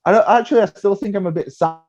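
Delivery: high, playfully grudging yes; mock reluctant agreement. Accepting the Antigua trip, mock grudging and high. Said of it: "I was like".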